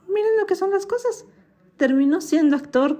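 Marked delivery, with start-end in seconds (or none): 0.1-1.3 playful ironic aside. Real, not acted. Ironic aside about fate.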